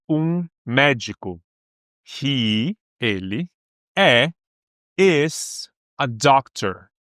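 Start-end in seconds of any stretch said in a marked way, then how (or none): 2.0-6.9 slow teaching model. Shown as a demonstration.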